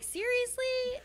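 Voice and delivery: high, slow disbelieving; incredulous. Real, not acted. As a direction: stretch it out in disbelief. Indignant retort.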